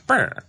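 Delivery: squeaky high brr; a silly high-pitched brr; high mock shiver noise. Mocking sound after quote.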